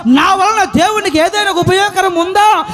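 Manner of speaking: driving sermon delivery in long rolling runs; impassioned preaching. Acted. Preacher urging the flock to face every enemy, impassioned. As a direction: preach it at full force, piling up each item.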